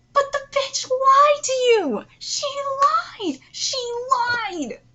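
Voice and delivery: high, loud drawn-out wail of protest; real furious screaming; outraged yelling. Real, not acted. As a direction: yell the accusation, stretching it out. Screams objection after reading thanks.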